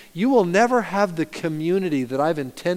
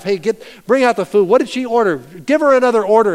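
raised, urgent orders to the waiters; urgent call to staff; 5 semitones higher, 7 dB louder than usual. Retold or imitated. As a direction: hurry the staff along, raised voice. Manager urging staff to help.

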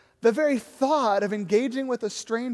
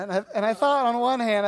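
ordinary talk

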